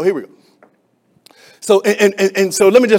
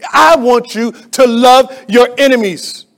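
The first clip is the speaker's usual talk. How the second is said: preaching exhortation; 6 semitones higher, 8 dB louder, slower than usual. Real, not acted.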